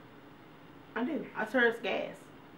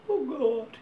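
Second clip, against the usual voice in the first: higher exasperated oh God; kids groaning; 7 semitones higher than usual. Retold or imitated. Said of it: "my kids are always like".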